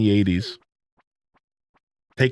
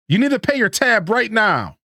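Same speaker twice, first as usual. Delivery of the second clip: higher, firm barkeep demand; firm bartender; 10 semitones higher than usual. Acted. Gus demands Pam pay her tab, firm. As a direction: insist she pays up.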